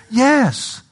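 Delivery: high, ringing pulpit cry; sudden high exclamation to the congregation; emphatic answer. Real, not acted. Preacher answers own question emphatically.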